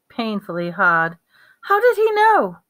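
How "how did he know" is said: high, loud, bewildered cry; urgent astonished question; alarmed question. Acted. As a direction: ask it in high-pitched alarm. Frightened character's urgent question.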